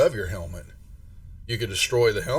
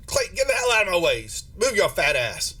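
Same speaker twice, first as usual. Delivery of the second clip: rude shove past; 7 semitones higher than usual. Acted. GM voices woman shoving past.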